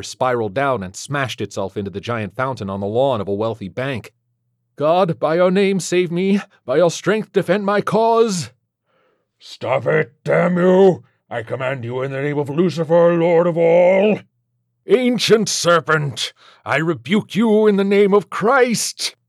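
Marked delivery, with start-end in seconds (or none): 4.8-8.5 high, loud plea to God; impassioned, raised-voice exorcist; fervent shouted prayer. Acted. Priest prays in exorcism. 9.4-14.2 loud demon building to a high roar; enraged demonic decree, rising; commanding demon Legion. Acted. 14.9-19.2 high, slow, loud exorcist's command; drawn-out priestly shout; thundered rebuke. Acted.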